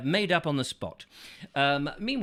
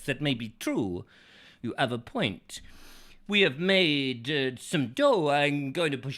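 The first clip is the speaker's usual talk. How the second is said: ordinary talk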